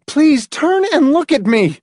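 desperate entreaty; begging. A narrator's reading. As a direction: implore him, voice way up. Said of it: "Marcia begged".

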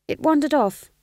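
usual speaking voice

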